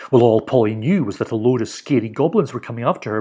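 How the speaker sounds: usual speaking voice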